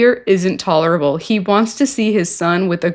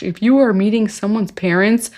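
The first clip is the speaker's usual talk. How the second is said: ordinary delivery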